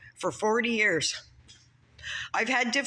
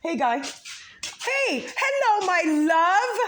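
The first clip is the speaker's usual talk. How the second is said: high, bright, louder hello; sing-song greeting; 10 semitones higher, 4 dB louder than usual. Real, not acted. Worker calls a cheerful sing-song hello to his coworker. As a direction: sing it out to a friend across the site.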